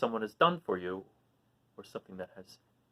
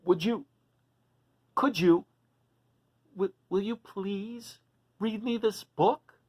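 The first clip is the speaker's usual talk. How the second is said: a hint of a shy dragon asking, slowing; gentle pleading character voice; lightly voiced Duncan pleading; 9 semitones higher, slower than usual. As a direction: ask politely and hopefully. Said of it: "Duncan pleads with the mouse".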